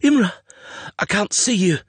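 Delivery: high, panicked call; frightened calling. A narrator's reading. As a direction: call out blindly into the storm.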